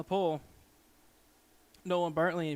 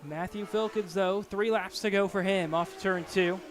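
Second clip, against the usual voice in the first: louder, energetic race call; live play-by-play; 4 semitones higher, 5 dB louder than usual. Real, not acted. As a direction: call the final laps live.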